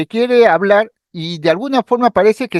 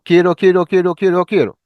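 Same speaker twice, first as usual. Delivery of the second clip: "quiero" repeated compulsively, fading at the end; palilalia demo; 2 semitones lower than usual. Shown as a demonstration. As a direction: demonstrate involuntary repetition of "quiero". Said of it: "Por ejemplo".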